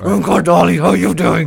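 loud, high-pitched doggy reply; boisterous cartoon dog greeting. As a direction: answer as one dog to another. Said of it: "they talk to each other".

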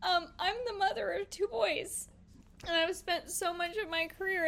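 holding back tears while speaking; real emotion, close to crying; fighting back tears. Real, not acted. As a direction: voice catching on the edge of tears. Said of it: "I'm trying not to cry right now".

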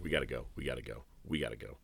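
quick hushed urgent mutter; low quick hurried warning. Retold or imitated. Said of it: "[name]'s like".

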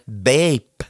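"Bape" as a Scanian diphthong; "Bape" like a bird from Skåne; Scanian bird call. Acted. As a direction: chirp "Bape" with a broad Scanian vowel. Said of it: "Fåglarna i Skåne säger".

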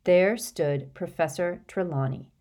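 plain reading voice

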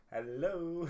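mock a friend's podcast greeting; imitating co-host. Co-host mimics the host's hello, imitating. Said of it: "my best impression of you going".

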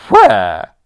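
loud, appalled grande dame cry; a scandalised aunt booming out the word; consternation. Acted. Aunt outraged at the butler, loud appalled gasp. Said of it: "vibrated with consternation".

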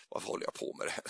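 breathy whisper to himself, amused; a quick whisper, half laughing at himself; whispered self-mockery mid-sermon; whispered aside. Real, not acted.

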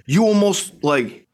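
high, slow mock whine; mocking the driver. Narrator mocks the driver repeating his complaint, high slow whine. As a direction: parrot the driver's words back in a whiny voice. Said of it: "he's like".

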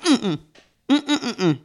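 giggles held in behind closed lips; stifled giggles. Real, not acted. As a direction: try not to burst out laughing. Holding back laughter after fright.

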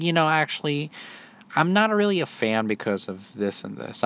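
a hint of a pretentious kid; annoying kid voice. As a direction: put on a mildly annoying kid's voice. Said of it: "annoying kid voice".

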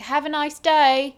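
bad attempt at a British shop farewell; hammed-up British goodbye from the staff; mock British accent. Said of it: "That was an Australian".

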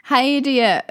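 greeting a friend with a playful insult; cheeky insult. Pretend. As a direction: fling it out playfully rude. Blunt insult acted.

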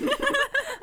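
high loud laughing burst; burst of laughter. Real, not acted. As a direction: crack up loudly. Cracks up at line.

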